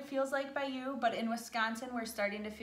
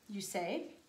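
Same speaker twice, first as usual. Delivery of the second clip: plain reading voice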